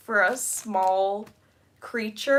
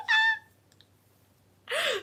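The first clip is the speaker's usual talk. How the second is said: very high-pitched squeal; excited squeal. Real, not acted. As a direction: squeal with delight. Thrilled by a new member.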